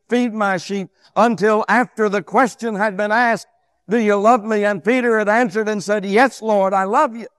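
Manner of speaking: loud, high pulpit delivery; full-force preaching. Real, not acted. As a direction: preach it out strongly, high and emphatic.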